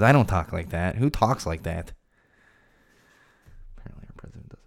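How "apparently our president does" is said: whispered punchline; sly whispered aside. Real, not acted. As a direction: whisper it like a secret joke. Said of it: "whispered punchline".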